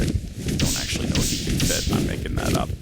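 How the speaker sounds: under your breath, half to yourself; a hushed admission to the camera; whispered aside. Real, not acted. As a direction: whisper it as a sly confession. Admits bluff to pet dragon.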